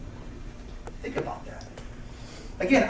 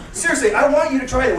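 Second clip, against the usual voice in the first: ordinary talk, nothing marked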